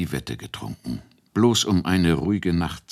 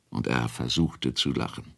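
ordinary delivery

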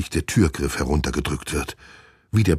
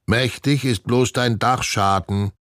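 high, slow mocking parrot squawk; croaking parrot; 7 semitones higher, 4 dB louder than usual. A narrator's reading. The parrot mocks Doktor Murks's plan, high slow croak. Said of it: "krächzt Herr Klodwig".

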